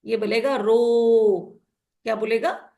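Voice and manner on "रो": "रो" with a long, drawn-out O; open-syllable "रो", vowel held long; long O sound. Shown as a demonstration. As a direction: stretch the vowel in "रो" like the letter name O. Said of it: "ये long sound बोलेगा".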